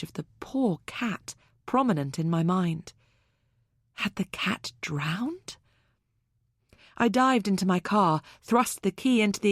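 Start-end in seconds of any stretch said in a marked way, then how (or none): 3.9-5.6 hushed unvoiced whisper. A narrator's reading. Narrator whispers her fearful thought about the cat. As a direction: breathe the question out in fear. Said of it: "inner thought, whispered".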